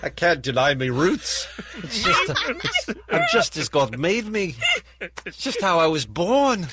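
put-on British accent, every line ending on a lift. Host back from Britain, insisting on his new British accent. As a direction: play a Brit who swears this is how he always talked. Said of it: "What's with the upswing of every last word?".

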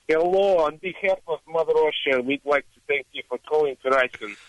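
mock Russian accent, lightly put on; a hint of a Russian call-centre agent. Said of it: "answering in a Russian accent".

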